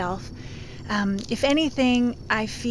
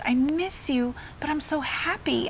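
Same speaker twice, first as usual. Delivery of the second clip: higher, softened imitation of a crying child; retold tearful confession; 6 semitones higher, 5 dB quieter than usual. Crying son tells mom he misses her, retold tearful confession. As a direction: lightly voice a child torn between feelings. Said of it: "so he started crying, and he goes".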